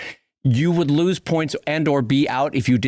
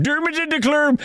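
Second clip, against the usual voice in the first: a much higher, mock screamed "Dermans into Club"; 11 semitones higher than usual. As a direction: yell the game's catchphrase in a mock Swedish chef accent. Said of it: "you had to scream".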